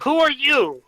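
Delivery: high, sharp landlady demanding a name; suspicious landlady. Acted. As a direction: challenge the stranger, lightly voiced landlady.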